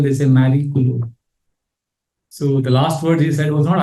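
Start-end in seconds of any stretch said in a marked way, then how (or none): 0.2-1.1 Italian "Miracolo" with a rolled r and open vowels; Italian word. Shown as a demonstration. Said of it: "you say, for miracle".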